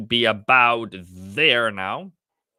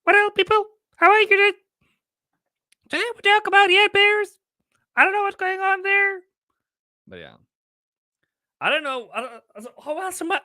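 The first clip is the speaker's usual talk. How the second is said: a different silly voice. Pretend. Trader greeting his stream chat in a put-on high squeaky voice. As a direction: greet the chat like a hyper cartoon character. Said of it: "let me speak differently".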